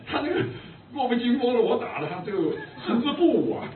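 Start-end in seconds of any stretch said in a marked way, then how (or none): none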